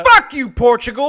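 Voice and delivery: loud, high comic rage; mock-angry outburst. Co-host curses Portugal over its idiom, mock-angry yell.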